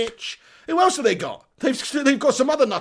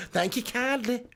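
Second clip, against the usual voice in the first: hammed-up generic Southern accent, pitched up; Southern belle accent; 5 semitones higher, slower than usual. Shown as a demonstration. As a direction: put on a cheesy Texan belle accent. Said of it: "Southern Belle accent".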